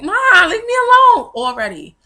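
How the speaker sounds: put-on high, loud, fed-up baby voice; mock-annoyed baby, high and loud; newborn with attitude. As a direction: whine at Mom like a bossy newborn. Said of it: "opened her eyes and side-eyed me like".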